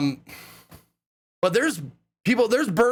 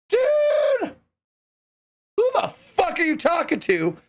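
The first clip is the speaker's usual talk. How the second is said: mock outraged yell; 11 semitones higher than usual. Retelling yelling at a stranger who asked about Frederick, mock outraged. Retold confused stranger at camp.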